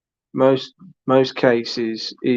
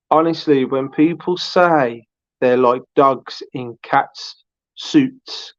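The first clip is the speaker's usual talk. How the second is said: ordinary talk, nothing marked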